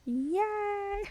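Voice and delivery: excited cheer. Real, not acted. Celebrates the chat fix.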